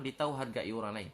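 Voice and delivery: ordinary talk, nothing marked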